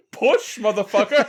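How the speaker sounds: loud laughing urging; mock cheering shout. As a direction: yell it like a coach. Cheering friend on.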